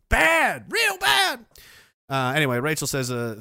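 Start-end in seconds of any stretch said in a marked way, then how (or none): 0.1-1.5 high-pitched hammed-up scolding; mock scolding shout. Streamer sarcastically branding himself the bad guy, mock scolding shout. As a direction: exclaim it high like an exaggerated telling-off.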